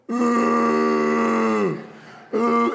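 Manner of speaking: long high held wail; mock bawling; imitated crying wail. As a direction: wail "ooh" high on one note. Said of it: "he's crying".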